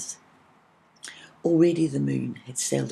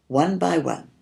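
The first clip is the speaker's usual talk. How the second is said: ordinary delivery, nothing marked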